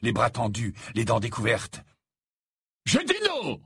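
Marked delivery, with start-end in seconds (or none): 2.9-3.6 sharp shout. A narrator's reading. Death Eater stopping Greyback, sharp high shout. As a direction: cut him off hard. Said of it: "s'écria l'homme".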